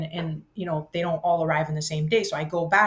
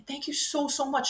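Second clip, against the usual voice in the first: gushing thanks; 7 semitones higher, 4 dB quieter than usual. Retold or imitated. Repeated thanks, high and soft.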